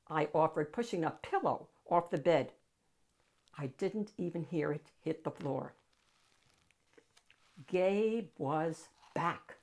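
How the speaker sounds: plain reading voice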